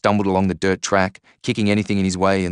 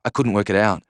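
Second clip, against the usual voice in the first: usual speaking voice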